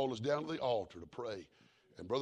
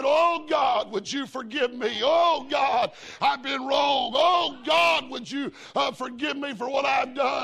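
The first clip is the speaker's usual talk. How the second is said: shouted, high desperate prayer; crying out to God; 14 dB louder, slower than usual. Retold or imitated. Matthew in the hospital begging God's forgiveness, shouting. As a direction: cry it out as a broken sinner.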